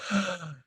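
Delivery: a sudden quiet intake of breath; sharp gasp. Retold or imitated. Sudden realization when the guide asks, sharp gasp. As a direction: gasp softly as the truth lands.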